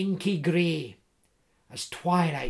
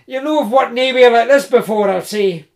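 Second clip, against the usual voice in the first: big hearty Scots voice, louder and higher; jovial Scottish woodsman; 4 semitones higher, 11 dB louder, faster than usual. Acted. Tree surgeon teasing Jim in the dark woods, hearty Scots. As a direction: tease him like a cheerful giant.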